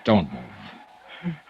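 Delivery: low soft warning; gentle hush. Acted. Dollar holding the dying Abbott still, soft voice. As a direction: keep him still gently.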